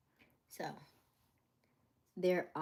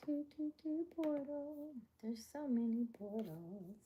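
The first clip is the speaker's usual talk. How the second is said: much higher, slow, odd put-on voice; mock voice changer; 9 semitones higher, slower than usual. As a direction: play a warped voice-changer voice, drawn out.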